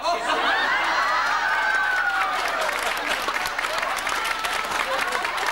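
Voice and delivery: very high, long fit of helpless laughter. Real, not acted. Host cracking up at the audience member's suggestion, long helpless laugh. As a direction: break up laughing, can't stop.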